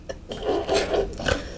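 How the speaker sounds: soft, wheezy laughter; laughing. Real, not acted. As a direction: laugh yourself out of breath. Cracking up at meme.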